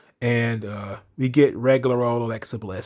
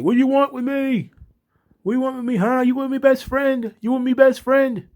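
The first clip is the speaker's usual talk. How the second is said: high mock Nikki Cross voice, much higher all through; Nikki Cross impression; 12 semitones higher than usual. Mocking Nikki Cross taunting Alexa in the ring, high mock voice. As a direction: squeal it up high like a crazed wrestler. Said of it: "she's like".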